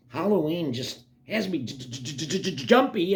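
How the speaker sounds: jittery stammer bursting into the word; nervous stutter. Acted. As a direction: stutter repeatedly on the first sound. Jittery character stammers on jumpy.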